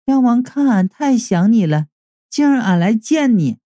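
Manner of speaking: a lightly voiced coy woman with a country twang; sweet but somehow off; oddly rustic woman. A narrator's reading. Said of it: "我总觉得他说话怪怪的呢". (带点怪腔调的娇声)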